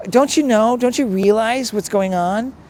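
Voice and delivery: high, loud indignant demand at the birds; ticked-off complaint. Retold or imitated. Priest venting at the birds in frustration, indignant and high. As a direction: throw the question up at the sky, exasperated. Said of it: "And I'm just ticked".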